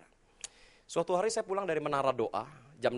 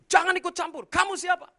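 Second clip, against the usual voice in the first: loud, high furious snap; angry shout; 6 dB louder than usual. Retold or imitated. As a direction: yell it at the pastor's face. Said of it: "saya bilang begini". (bapak itu membentak pendeta dengan marah)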